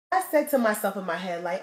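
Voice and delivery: normal talking voice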